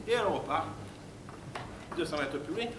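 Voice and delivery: ordinary talk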